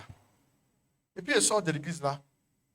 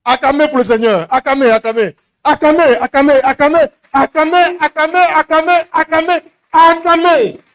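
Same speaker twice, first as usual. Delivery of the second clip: loud rising chant of acclamez; loud worship cheer; 8 semitones higher, 7 dB louder than usual. Retold or imitated. Preacher imitating the noisy evangelical service, loud chant. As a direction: mimic the church leader whipping up the crowd. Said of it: "puis ils faisaient du bruit".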